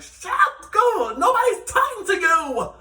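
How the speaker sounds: loud, high, drawn-out yell; triggered mock shout. Vlogger retorts to castmate's trigger phrase, high exaggerated shout. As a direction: shriek it back in exasperation, half laughing. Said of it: "it's like a trigger for me".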